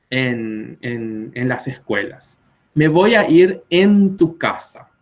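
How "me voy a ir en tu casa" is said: slow, higher and louder demo of the Paraguayan phrasing; Paraguayan Spanish error. Shown as a demonstration. Teacher showing students a typical classroom error, deliberate slow demo. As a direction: show the classic Paraguayan calque, slowly. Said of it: "Este es un error típico".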